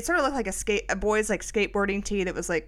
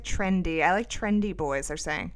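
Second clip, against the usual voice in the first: ordinary talk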